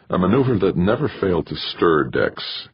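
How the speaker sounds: plain reading voice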